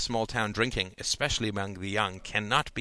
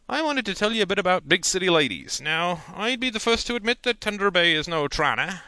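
higher, louder folksy uncle; lecturing uncle; 8 semitones higher, 5 dB louder than usual. A narrator's reading. Uncle warns nephew about Big City Ladies, folksy voice. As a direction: talk down to the boy kindly.

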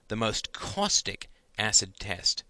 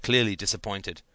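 ordinary delivery

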